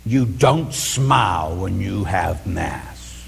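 a hint of a stern mother scolding her son; slow, low reproach. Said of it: "my mother came to me and she says".

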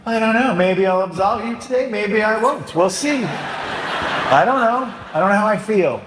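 high, wavering mock priest, half laughing; indecisive priest. Priest mocking vague penitents, high and wishy-washy. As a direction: waffle teasingly about absolution. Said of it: "What if I said".

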